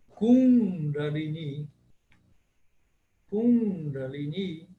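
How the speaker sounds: Kundalini slowly, second i held long; Kundalini short then long i. Shown as a demonstration. Teacher modelling Kundalini for students. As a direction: stretch the last i of Kundalini. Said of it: "o primeiro i é breve".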